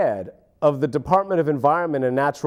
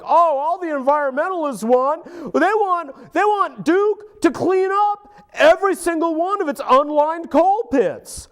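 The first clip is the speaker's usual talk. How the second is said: high, louder mock voice of a dismissive official; a higher, louder parody of an official brushing off environmentalists; 10 semitones higher, 6 dB louder than usual. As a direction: sneer it out high as the smug bureaucrat. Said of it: "He says things like".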